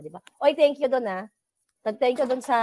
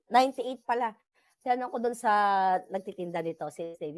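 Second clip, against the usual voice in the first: normal talking voice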